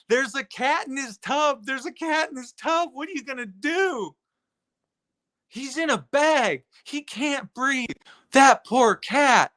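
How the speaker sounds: mock Teresa. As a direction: imitate a fretful woman fussing over a cat.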